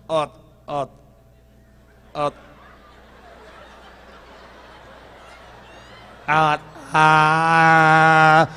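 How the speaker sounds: long stuck stuttered uh; mock stammer at the bus door; stuttering uh. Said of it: "he stutter real bad".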